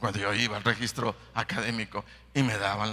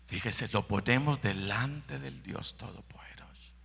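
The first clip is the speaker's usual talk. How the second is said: hushed aside; 7 dB quieter than usual. Real, not acted. Preacher drops to a whisper.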